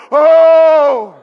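long loud mock "oh" cry; drawn-out imitated howl; hammed-up wail. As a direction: wail it out like a desperate worshipper. Imitates Baal worshippers crying out.

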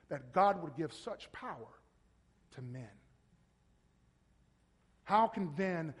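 normal talking voice

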